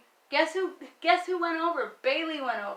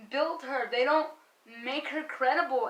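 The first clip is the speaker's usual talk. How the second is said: ordinary delivery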